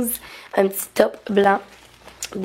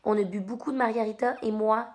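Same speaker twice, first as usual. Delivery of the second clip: normal talking voice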